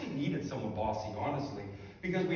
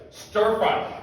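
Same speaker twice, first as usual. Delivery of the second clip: blunt answer; 5 semitones higher, 10 dB louder than usual. Retold or imitated. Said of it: "She's like".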